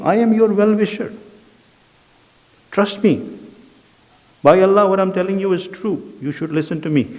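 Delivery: mock sincere Satan. Teacher voicing Satan swearing to Adam, high and eager. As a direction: plead like a smooth deceiver promising truth.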